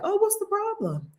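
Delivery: high-pitched imitation of puzzled people; mock puzzlement. Imitating people who see no problem, high mock puzzlement. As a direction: lift it high, playing innocently baffled.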